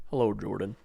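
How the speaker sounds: talking car voice. Acted. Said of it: "And he sounds like that".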